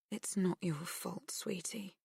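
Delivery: fading to a whisper; soft soothing. A narrator's reading. Mum comforting her daughter at bedtime, softly whispering.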